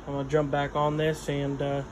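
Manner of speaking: ordinary delivery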